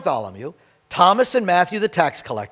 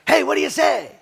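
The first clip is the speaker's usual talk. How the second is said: lightly voiced friendly hello, pitched up; friendly greeting; 7 semitones higher than usual. Pastor repeating his greeting to students, lightly cheery. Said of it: "I'm giving a greeting".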